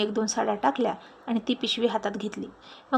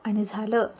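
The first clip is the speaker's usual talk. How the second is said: ordinary delivery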